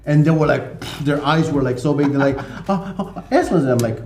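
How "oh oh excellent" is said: high-pitched flustered imitation; mock surprise. Two girls caught gossiping by a foreigner, mock surprised. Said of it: "they were really surprised".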